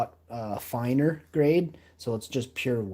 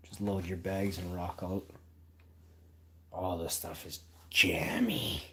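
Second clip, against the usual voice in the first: whispering; 5 semitones lower, 8 dB quieter than usual. Real, not acted. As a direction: breathe the words out. Whispering over the hash.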